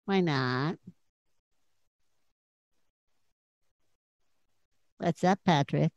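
croaky, low voice; froggy voice. Pretend. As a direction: croak it out like a frog in the throat. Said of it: "Sounds like you got a frog in your throat".

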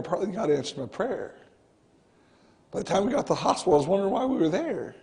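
ordinary talk

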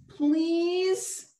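high drawn-out please; pleading cyclops. Acted. Cyclops puppet begging the cake, high and drawn out. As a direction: beg nicely.

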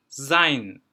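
"sein" loud and drawn out as "zayn". Shown as a demonstration. Teacher showing S turned to Z, loud careful demo. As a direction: say "sein" slowly with buzzing z and "eye". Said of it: "diye okunur".

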